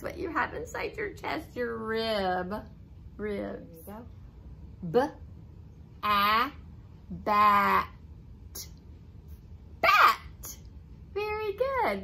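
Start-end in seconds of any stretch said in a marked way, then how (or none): 4.9-7.9 each sound "n", "a" said separately, then blended into "nut". Shown as a demonstration. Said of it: "say the blend and then add". 9.8-12.0 excited. Acted. Praising a child's reading.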